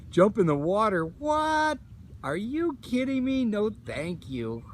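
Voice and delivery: incredulous. Real, not acted. Reacting to a dare to swim.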